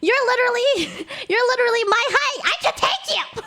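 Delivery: high mock challenge, bubbling with laughter; retold yelling. Traveller challenging the man walking off, laughing mock shout. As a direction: shout the taunt high while cracking up. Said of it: "I just kept yelling at him".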